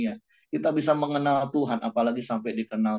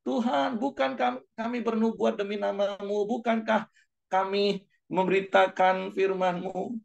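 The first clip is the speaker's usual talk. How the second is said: high, whiny pleading to the Lord; mock desperate plea, much higher; pleading cry; 11 semitones higher, slower than usual. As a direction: beg like a desperate believer. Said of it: "Walaupun kita teriak".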